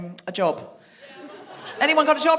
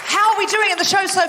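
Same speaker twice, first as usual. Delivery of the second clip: host calling out; 12 semitones higher than usual. Real, not acted. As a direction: whip the crowd up.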